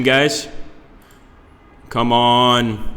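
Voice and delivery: ordinary delivery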